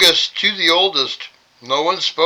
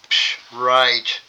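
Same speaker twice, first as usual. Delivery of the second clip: scoffing; 4 semitones lower than usual. Real, not acted. Scoffing aside at the lie.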